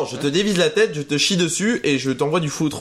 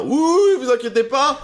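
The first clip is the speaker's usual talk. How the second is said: put-on blackout drunk; 9 semitones higher, slower than usual. Mocking drunk friend's reassurance.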